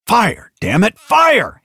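frantic high shouted command; urgent, exasperated yelling; yelled order. A narrator's reading. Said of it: "Hicks yelled".

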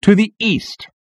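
high, portentous soldier's voice; high-pitched, meaningful report; significant addition. A narrator's reading. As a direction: add it as a weighty revelation. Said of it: "narrowing his eyes significantly".

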